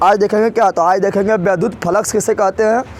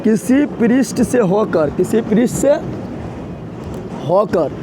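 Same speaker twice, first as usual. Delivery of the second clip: slow, broken dictation of a definition; slower than usual. Acted. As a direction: spell it out slowly for note-taking.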